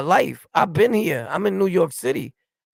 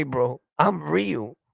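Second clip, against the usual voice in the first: slow mocking quote; 4 dB quieter, much slower than usual. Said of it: "He didn't even say".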